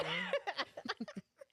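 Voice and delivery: high, squealing laugh. Real, not acted. Storyteller laughs at coworker's greeting, squealing. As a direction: crack up mid story.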